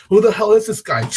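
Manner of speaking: shocked mob boss. Retold or imitated. Mob boss reacting to Batman.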